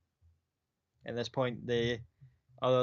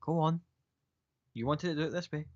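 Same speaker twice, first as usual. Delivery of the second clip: dismissive; 4 semitones higher than usual. Acted. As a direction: wave them on impatiently.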